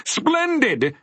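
very high, exclaimed praise; delighted cry. A narrator's reading. Dodecahedron praising Milo's answer, delighted cry. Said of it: "cried the dodecahedron".

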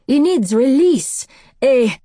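put-on loud, high, drawn-out French-accented plea; great distress. A narrator's reading. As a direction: insist desperately in a French accent. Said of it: "Asher was in great distress".

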